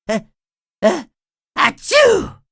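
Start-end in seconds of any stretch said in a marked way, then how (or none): 1.6-2.4 loud high acted sneeze; big sneeze. SpongeBob sneezing at work, loud cartoon sneeze. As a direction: let the sneeze explode.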